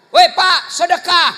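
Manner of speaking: loud playful reminder; calling out. Retold or imitated. Wife calling her husband back for his kiss, loud and playful. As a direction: call after him loudly.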